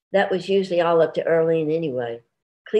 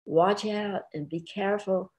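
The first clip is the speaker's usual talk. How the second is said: ordinary delivery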